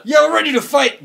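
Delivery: hyped call. Acted. Player hypes the fight.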